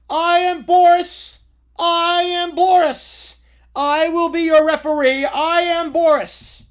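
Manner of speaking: loud, slow ring-announcer proclamation; booming referee Boris. Acted. Boris announces himself to the fight crowd, slow and booming. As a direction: drag out each word like a grand announcer.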